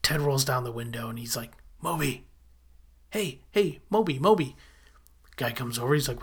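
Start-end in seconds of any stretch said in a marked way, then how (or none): 1.8-4.5 retold calling out. Ted hailing a man from the limo, mock calling out. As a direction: imitate calling someone over, not shouting.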